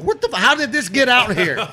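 high, laughing disbelief at a strange sight; retelling his own baffled reaction; shocked disbelief. Retold or imitated. Friend retelling finding the cart, laughing disbelief.

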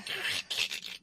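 voiceless creaking, scraping noise; imitated boat creak. Sailor imitating the boat's noise against the catamaran, creaking. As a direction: make the crunch of wood rubbing on wood. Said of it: "hear the boat, like, making the".

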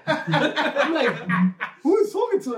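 high, incredulous question through laughter; laughing disbelief. Retold or imitated. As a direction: laugh while acting out being baffled. Laughing as he retells his bafflement.

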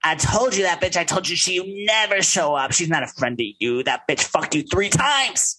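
put-on high, slurred drunken rant; high drunk scolding voice; drunk Erica. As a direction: rant like a wasted friend.

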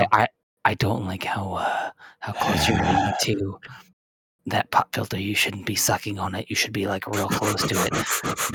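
mock ASMR voice. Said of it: "You both have terrible A S M R".

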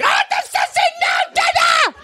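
very high-pitched screaming tantrum; child's screamed demand. Acted. Child demands food from dad, screamed tantrum. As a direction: screech it like a furious impatient small child.

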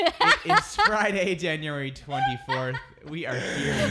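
giggling through the words; laughing. Real, not acted. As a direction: laugh while announcing. Host laughing through the intro.

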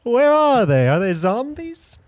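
high, loud mock New York accent, gushing; hammed-up New Yorker raving about Times Square. Mocking New Yorkers loving their city, New York accent. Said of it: "my New York accent's getting way worse".

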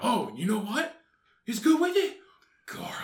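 lightly voiced fat superhero; fat Batman. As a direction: relish the food like a hero. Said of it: "you're fat Batman".

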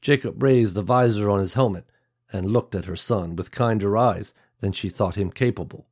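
usual speaking voice

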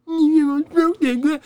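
very high, squeaky mock parroting; mocking echo. Drunk friend mimicking her scolding in a squeaky high voice.

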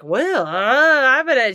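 imitating Lisa loud after a few drinks; mock loud drunk. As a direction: ham up a tipsy proclamation. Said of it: "she was, like, loud".

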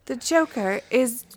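mock the garbled Siri reply; imitating Siri. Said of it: "and she's like".

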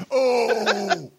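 high, drawn-out "oh"; long high whoop of fake outrage; mock shocked cry. Host reacting to his own mum joke, high mock cry.

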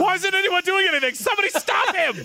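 shrill panicked cry for help; panicked high shout. Acted. [name] panicking in character.

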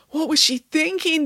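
high-pitched despair. A narrator's reading. Michael on his jailed nanny, high despairing cry. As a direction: lament it in disbelief. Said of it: "despaired Michael".

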